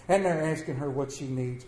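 ordinary delivery

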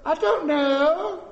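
put-on high, slow, drawn-out child's answer; clueless child. Child asked why he drank it, clueless drawl. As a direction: stretch it out like a baffled kid. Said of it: "the child says".

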